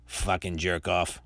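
ordinary talk, nothing marked

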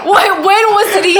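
high, very loud demand; incredulous shout. Real, not acted. Player challenging the game master on difficulty, incredulous shout. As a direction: shout it in disbelief amid laughter. Player keeps protesting hard fights.

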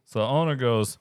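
usual speaking voice

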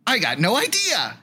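a hint of a cartoon critter; talking animal. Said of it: "do a funny voice".

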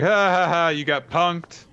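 high fake laugh into a taunt; hammed-up prankster's crowing; mock gloating. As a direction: gloat with a put-on ha-ha-ha. Acted villain laughing at victims.

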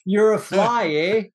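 bad comic Canadian accent; mock Canadian accent. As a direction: do a joking Canadian with a tagged-on "eh". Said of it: "nobody had a Canadian accent either".

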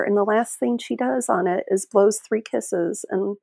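normal talking voice

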